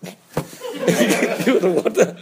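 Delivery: chuckling through the words, slowed right down; laughing. Real, not acted. Teacher laughing as he tells students of his tap-water prank. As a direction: giggle out the punchline of the prank.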